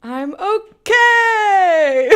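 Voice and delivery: high laughing squeal, very slow. Real, not acted. Playful reply, laughing.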